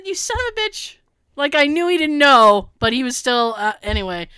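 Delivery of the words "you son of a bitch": mock insult.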